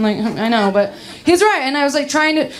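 ordinary talk

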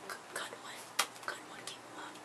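very soft whisper; hushed little aside, barely there. Real, not acted. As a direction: whisper it under your breath. Whispered aside after joke.

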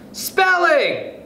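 very high-pitched class cheer; children's cry. A narrator's reading. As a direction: call it out like eager schoolchildren. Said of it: "cried the children".

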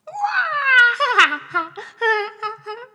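high, very loud tickled cackle; mummy's shrieking laugh. Acted. The mummy being tickled, shrieking laughter.

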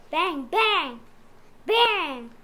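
punchy gunshot bangs; 1 loud bangs. A narrator's reading. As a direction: hit each bang hard. Hunters' gunshots.

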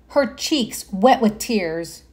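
ordinary delivery

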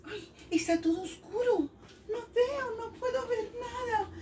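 high, soft, plaintive pretend fretting; mock bewilderment. Teacher pretends to children she can't see, mock bewildered. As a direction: play lost in the dark, voice small and high.

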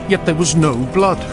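ordinary delivery

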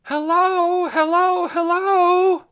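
calling out across hills at full voice; high loud shouts into the dark; shouting. A narrator's reading. Dune calling for Lena across the hills, shouting. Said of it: "The voice rang out".

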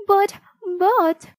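high, loud, broken-off objection; flustered protest. A narrator's reading. Mother objecting to the man at the church door, flustered protest. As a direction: protest sharply, stopping after each word.

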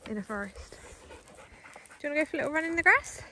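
normal talking voice

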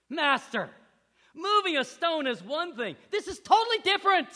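high, loud protest rising in pitch; pleading Luke. Retold or imitated. Luke protests to his master, high and pleading. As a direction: whine like a frustrated student. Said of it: "Luke goes".

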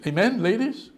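ordinary talk, nothing marked

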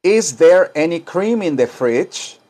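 very slow, rising question; slow model question. Shown as a demonstration. Teacher models a slow question for class. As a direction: ask it slowly for students.

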